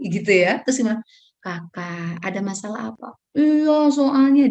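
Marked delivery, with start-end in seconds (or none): 1.4-3.1 lowered volume. Shown as a demonstration. Parent asking a shouting child what's wrong, lowered volume. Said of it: "maka volume kita". 3.4-4.5 put-on high, loud, drawn-out child's reply; complaining child. Child answering a calm parent, complaining voice.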